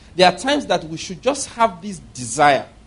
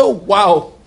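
excited Lord; 2 semitones higher, 5 dB louder than usual. Acted. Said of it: "The Lord was excited".

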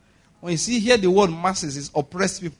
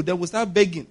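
ordinary delivery

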